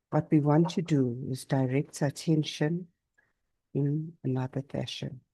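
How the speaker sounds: unhurried yoga nidra voice with long pauses; guided meditation. Real, not acted. Teacher guiding listeners to notice body and breath, calm meditation. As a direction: guide them gently through the body, leaving space after each phrase.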